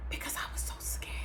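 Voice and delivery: quick, hushed admission; fearful whisper. Real, not acted. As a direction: whisper it fast. Recalling her fear.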